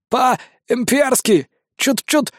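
very slow, strained, holding back a groan; through gritted teeth. Acted. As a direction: squeeze the words out past the pain. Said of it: "процедил сквозь зубы он, … едва сдерживая стон".

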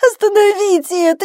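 very high, loud agonised wail; screaming in pain. A narrator's reading. Bella hit by another contraction, screaming in pain. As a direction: shriek it out in agony. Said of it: "закричала от боли".

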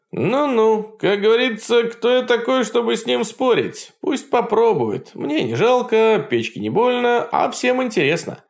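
высокий ехидный голос печки; поддразнивай свысока, как говорящая печь; насмешливая печка. Acted. (говорящая печь подначивает Кощея, высоко и ехидно)

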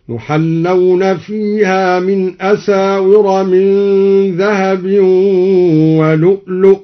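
slow chant, stopping on ولؤلؤ with no final long "a"; genitive reading of ولؤلؤ. Shown as a demonstration. Teacher demonstrates al-Duri's reading, ولؤلؤ chanted to a stop. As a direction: chant slowly and end ولؤلؤ on "lu'". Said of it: "بجر لؤلؤا".

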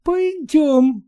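high curt command; stern wife. Acted. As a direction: order him off sharply. Wife ordering husband.

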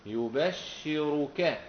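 يبشرك as slow yu-bash-shi-ru-ka; doubled-sheen reading. Shown as a demonstration. Teacher showing students the reading, يبشرك as yu-bash-shi-ru-ka. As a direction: hold the double sh in يبشرك, long drawn syllables. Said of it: "وأثقل أي شدد".

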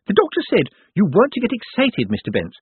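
a fussing carer covering her fear; nervous nurse. A narrator's reading. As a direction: Miss Drapes fussing over her patient, high and jittery.

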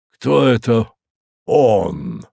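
stern, demanding question. A narrator's reading. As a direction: ask it sternly. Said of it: "спросил он строго".

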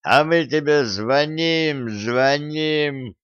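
slow, slurred mumble; mumbling. Acted. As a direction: mumble it like a drunk. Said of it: "промычал Дон".

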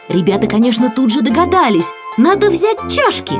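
ordinary delivery, nothing marked

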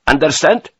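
high, loud demand; sharp challenge. A narrator's reading. Officer confronts his major, sharp and loud. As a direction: throw the word out as a challenge.

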